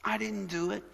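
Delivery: put-on high-pitched defensive kid voice; mock kid dodging blame, pitched high; whiny blaming kid. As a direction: plead innocence like a child passing the blame. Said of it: "he tells our kids to blame everybody else".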